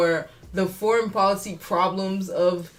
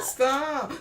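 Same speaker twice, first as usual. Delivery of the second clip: higher, softer mock plea; feeble, hammed-up objection; Joe Biden; 4 semitones higher, 6 dB quieter than usual. Mocking a president telling Netanyahu to stop, softly and half-heartedly. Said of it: "That's how Joe Biden said".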